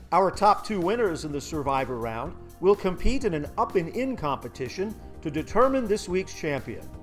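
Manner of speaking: ordinary delivery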